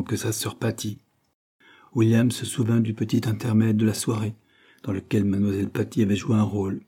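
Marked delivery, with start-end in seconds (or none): none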